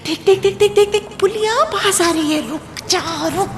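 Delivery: urgent urging. Acted. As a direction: push her to stop the car now. Inner voice urging her to stop.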